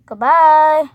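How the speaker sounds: ordinary talk, nothing marked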